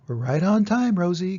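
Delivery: a cheerful farmer who made it in time, called out up high; pleased farmer. Acted. Farmer pleased, hurrying to train.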